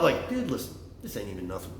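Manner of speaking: ordinary talk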